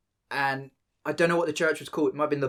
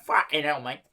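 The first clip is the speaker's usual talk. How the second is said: short Cockney exclamation; Cockney accent. Acted. Showing friends the missed accent, Cockney. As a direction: toss out a matey London Cockney curse. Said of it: "Cockney was on there".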